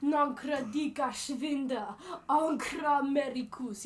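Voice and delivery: slow, loud chant-like shout; demonstrated war cry. Shown as a demonstration. Showing the interviewer how to fake languages, slow loud war cry. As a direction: draw the invented words out as a battle call.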